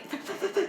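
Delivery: high, stuttered stammering noise; quick mock stutter; imitated stuttering. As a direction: mimic being stuck on the first sound. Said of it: "like, stuttering and".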